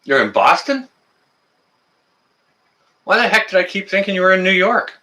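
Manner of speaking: high, amazed self-rebuke; surprised. Real, not acted. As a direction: catch your own mix-up, startled. Realizes viewer is in Boston.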